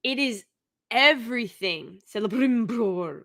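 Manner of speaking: ordinary talk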